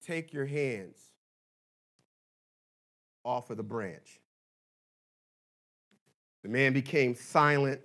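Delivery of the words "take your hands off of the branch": a hint of God calmly answering; lightly voiced God. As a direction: speak slowly like a patient God. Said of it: "And he said".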